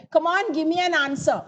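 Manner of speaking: insistent command, give me an answer; commanding demand. Shown as a demonstration. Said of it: "example for imperative sentence".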